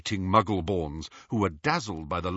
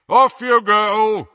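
slow loud booming order; 10 semitones higher, 11 dB louder, slower than usual. A narrator's reading. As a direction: boom it to the crowd. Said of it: "he boomed".

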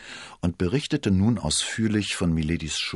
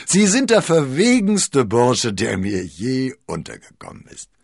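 amused admiration; 7 semitones higher, 8 dB louder than usual. A narrator's reading. Cardinal calls D'Artagnan the boldest fellow, delighted. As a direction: crow it with amazement. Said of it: "ein dröhnendes Lachen".

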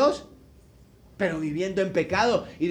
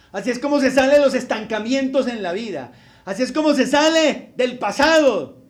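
loud, rising preacher's warning; preaching at full force; 3 semitones higher, 7 dB louder than usual. Acted. Preacher warning the congregation about sin, loud and fervent. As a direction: thunder the warning from the pulpit.

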